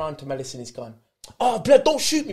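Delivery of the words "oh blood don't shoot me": loud, high fake panic; mock pleading. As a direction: plead loudly as if mocking someone dangerous. Retold player mock-begging not to be shot.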